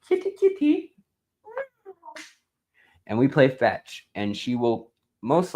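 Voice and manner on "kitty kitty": tiny, very high pet call; squeaky high call for kitty; cat-calling voice. Shown as a demonstration. Owner shows how he calls the cat, cat-calling voice. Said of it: "I say".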